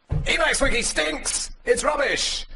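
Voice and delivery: high, slow mock rant voice; mocking Bozidir. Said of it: "along comes Bozidir and goes".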